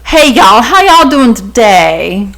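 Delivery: slow, exaggerated Southern accent; made-up Southern accent. Mocking Laney's accent as made up, exaggerated Southern accent. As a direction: ham up a Southern drawl. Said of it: "it sounds so made up".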